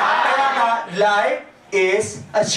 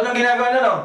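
normal talking voice

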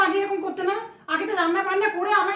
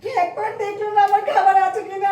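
ordinary talk, nothing marked

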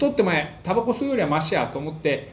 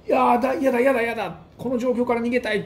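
mock childish whining, higher pitch; whiny refusal; 7 semitones higher than usual. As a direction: whine like a cornered kid who wants out. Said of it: "と思うんで".

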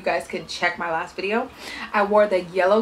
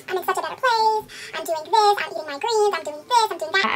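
mocking fake happy; 11 semitones higher than usual. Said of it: "People be like".

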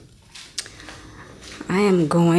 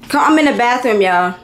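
calling out; 2 semitones higher, 6 dB louder than usual. Real, not acted. Calling family from bathroom.

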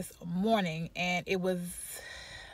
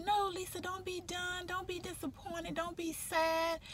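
high, much softer, gentle reassurance; soothing friend; 8 semitones higher, 8 dB quieter than usual. Retold or imitated. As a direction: coo it like comforting a sad friend. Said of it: "she was like".

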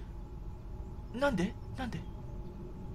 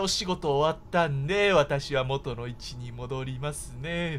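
polite deadpan voice; 8 semitones lower, 5 dB quieter, slower than usual. Retold or imitated. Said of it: "って".

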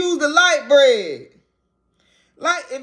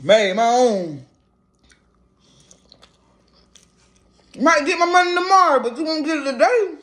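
speaking between bites; chewing while talking. Real, not acted. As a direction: mouth partly full. Seen 4: Eats sandwich; bites and chews between phrases, talks with food in mouth.

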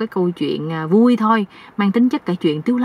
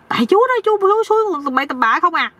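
high-pitched aggrieved nephew; accusing; 11 semitones higher, faster than usual. Acted. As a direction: protest and lay blame in a high voice. Nephew blames uncle again. (giọng cao, oán trách)

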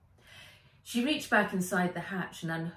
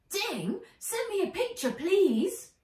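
put-on higher girl's voice calling her robot; calling out, a touch higher; Wen's bright call; 14 semitones higher, 4 dB louder than usual. A narrator's reading. Wen asks her robot for a picture, higher call. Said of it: "said Wen".

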